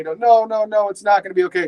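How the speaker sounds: high, quick, hammed-up protest; mock distraught wife. Sobbing wife rejects her husband's comfort, mock distraught. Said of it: "she's sobbing and she's crying".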